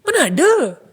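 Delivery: high, loud and fast insistence; agitated denial. Retold or imitated. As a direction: deny it sharply, rushing. Friend denies calling, agitated.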